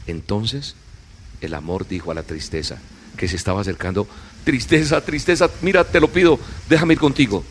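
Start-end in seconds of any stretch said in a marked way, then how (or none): 4.4-7.4 high, louder begging; Love pleading with Sadness, high and louder. Acted. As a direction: ask from up high, a little louder.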